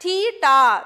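تھیٹا said slowly and loud, aspirated t, falling tune; clear theta pronunciation. Shown as a demonstration. Teacher models تھیٹا loudly so pupils can copy. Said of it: "repeat کیجئے میرے ساتھ".

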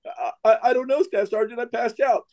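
high, stammering excuse; flustered Marine. Retold or imitated. Fainted Marine excusing himself to staff sergeant, high flustered imitation.

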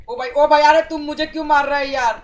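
loud, high-pitched gamer outcry; loud protest. Real, not acted. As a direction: shout in protest at a teammate attacking you. Protesting being attacked in game.

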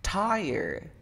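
TIRE in a gringo accent, high punch on the first syllable. Shown as a demonstration. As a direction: say "tire" like a gringo, force at the start. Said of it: "ponle la fuerza de voz al inicio y dilo como gringo".

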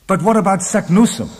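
high, loud, urgent question; agitated cry. A narrator's reading. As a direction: cry out in protest. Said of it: "I cried".

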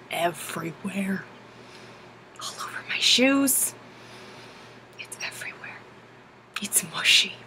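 low hushed disgust; soft whispered disgust at goose poop. Real, not acted. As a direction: whisper it like it's gross.

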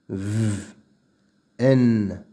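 ordinary talk, nothing marked

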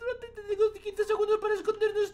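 high urgent frightened hush; whispering while hiding. Real, not acted. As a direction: hurry them into hiding, high and soft.

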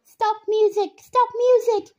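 high, loud repeated shout; urgent coach yelling across the rink. A narrator's reading. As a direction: call out to stop the music. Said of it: "Alexei shouted".

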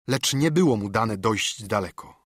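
usual speaking voice

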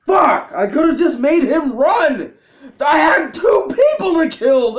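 high, loud frustrated ranting; real near-crying outburst; anguished shouting. Real, not acted. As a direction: wail it, furious with yourself. Player berates self over mistake.

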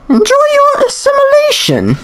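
very high computer queen villain; gloating farewell in a high regal voice. Acted. Computer queen leaving children to assimilation, high gloating voice. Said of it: "I'll give her a voice".

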